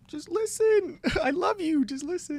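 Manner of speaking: very high, loud pet-talk voice; baby talk to a dog. Retold or imitated. Talking sweetly to his dog.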